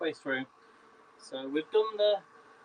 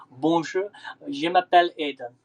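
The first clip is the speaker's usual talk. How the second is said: showing off a French accent; louder, playful beginner's French accent; 5 dB louder than usual. Shown as a demonstration. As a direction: have a proud go at sounding French. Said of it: "How's my accent?".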